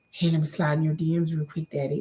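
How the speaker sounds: low, softened come-on; smooth pickup line. Acted. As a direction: slip into a low flirty mock voice. Acting a pickup line in DMs.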